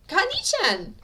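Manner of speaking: put-on high, loud little girl's squeal of delight; excited girl. A narrator's reading. Eri greeting Katsuki, high and loud childlike delight. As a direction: call out like a thrilled child.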